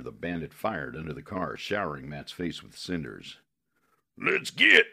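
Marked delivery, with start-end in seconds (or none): 4.2-4.9 high, loud croaky shout; croaking shout. Acted. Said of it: "he shouted in the croaking".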